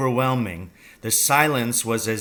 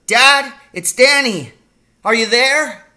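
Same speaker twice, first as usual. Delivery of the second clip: loud, high-pitched searching call; shouting; 13 semitones higher, 10 dB louder than usual. A narrator's reading. Boy searches the wood for his father, shouting. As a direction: call out far into the dark. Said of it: "I shouted".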